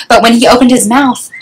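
ordinary delivery, nothing marked

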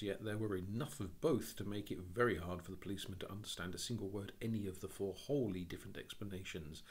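usual speaking voice

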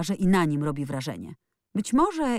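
ordinary delivery, nothing marked

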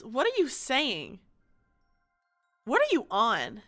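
usual speaking voice

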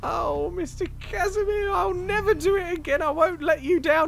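very high, crying squeaky voice; a scrawny bloke begging Casimir not to be cross; whimpering apology. Acted.

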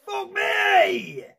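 real high shriek of fright; startled yelp. Real, not acted. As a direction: cry out high after a scare. Just been jump-scared.

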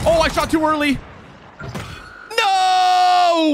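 rising into a real high yell of frustration; angry gamer yell at a missed shot; frustrated outburst. Real, not acted. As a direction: blurt out the miss, then shout no.

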